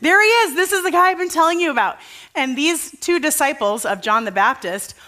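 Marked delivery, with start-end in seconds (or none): 0.0-2.0 high, loud and quick, bursting with news; excited pointing out. Retold or imitated. John the Baptist showing his followers Jesus, excited. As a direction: point him out like you can't wait. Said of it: "he tells them".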